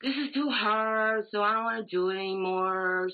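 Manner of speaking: mock a lazy quitter who gives up; lackadaisical whine. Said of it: "lackadaisical and be like".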